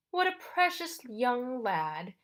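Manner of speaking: put-on condescending ferryman with a foreign-tinged British accent; strange British-like accent. Charon leans across the desk to the boy, strange British-like accent. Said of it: "He had a strange accent".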